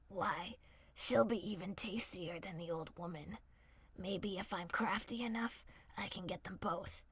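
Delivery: low crafty wolf muttering; scheming wolf. A narrator's reading. Wolf plotting to himself, low and hushed.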